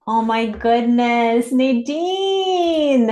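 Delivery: high, loud gushing praise; gushing excitement. Real, not acted. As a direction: gush over her, thrilled.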